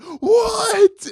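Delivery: shrieking high "What?"; a high disbelieving scream. Retold or imitated. Kid screaming at cash found in the creek. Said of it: "And I screamed".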